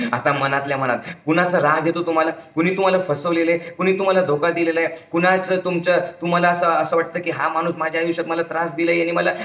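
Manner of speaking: ordinary talk, nothing marked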